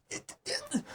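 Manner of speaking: whispered high squeak of delight; almost too thrilled to speak; breathless squeal. Real, not acted. Overwhelmed by cute scene.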